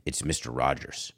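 very low, soft caller voice; hushed and deep, trying out each name; Aaron Rodgers introducing himself. Pretend. Aaron announcing himself to Woody, in a low hush. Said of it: "I wonder if he says it's Aaron".

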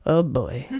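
low weary dad sigh; a hint of a tired father. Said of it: "He's just such a dad".

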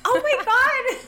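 very high, loud squeal of disbelief; real shock. Real, not acted. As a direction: shoot way up high, genuinely stunned. Learns her moon sign is different.

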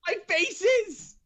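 very high, strained comic outrage; exasperated wail. Real, not acted. Podcaster ranting about his face in photo, exasperated wail. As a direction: squeal each complaint in mock despair.

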